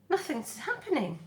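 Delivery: high gosling voice. Acted. Gosling voice in read story.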